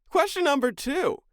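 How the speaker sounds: high, loud game-show announcement; sudden high-pitched, projected announcement; announcer call-out. Pretend. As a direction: belt out the next question like a host. Host calls out the next question.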